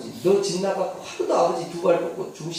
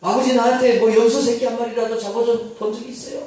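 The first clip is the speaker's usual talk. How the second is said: higher, louder bitter accusation; resentful shout; 6 semitones higher, 7 dB louder than usual. Acted. Elder son accuses his father of never giving him a goat, loud and high. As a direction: throw the grievance at him. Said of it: "막 그러면서".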